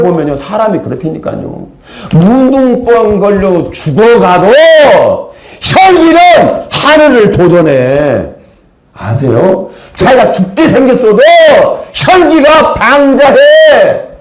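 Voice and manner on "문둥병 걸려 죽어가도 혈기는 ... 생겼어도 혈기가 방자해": slow, high, shouted sermon climax; fiery preaching. Real, not acted. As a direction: cry out the sinner's pride, slow and loud. Preaching at full force. (높고 느리게 외치는 설교)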